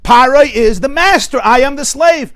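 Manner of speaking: loud, high-pitched cry of helplessness; preacher voicing a desperate slave; impassioned outcry. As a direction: cry it out at full force, stretching key words. Voices an emotionally helpless slave.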